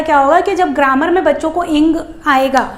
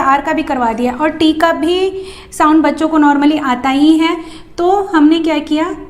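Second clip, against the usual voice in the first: ordinary talk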